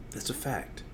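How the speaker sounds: very soft, offhand statement; quiet aside. Pretend. Host confirming his promise to record, quietly. First take of repeated catchphrase.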